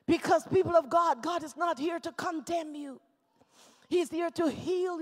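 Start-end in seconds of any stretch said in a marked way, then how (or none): none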